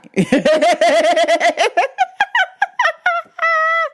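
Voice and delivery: high, rapid cackling laugh; real laughter. Real, not acted. Teller breaking up at his Tommy answer, real laughter. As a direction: crack up at your own joke. Cracks up at own bit.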